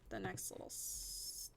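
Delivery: very soft, half-whispered counting; murmuring to oneself. Real, not acted. Player counting out blocks to herself, murmured. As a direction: count under your breath.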